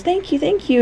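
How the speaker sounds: high, eager little thank-yous; grateful little character. Acted. Smiley is told he may go play, high eager thanks. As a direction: repeat thanks in a high cartoon voice. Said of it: "And he goes".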